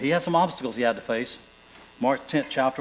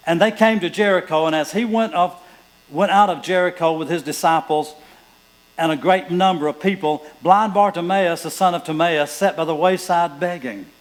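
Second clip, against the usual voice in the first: loud, raised preaching read of scripture; 4 semitones higher, 7 dB louder than usual. Acted. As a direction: proclaim the verses to the congregation. Reads scripture aloud in sermon.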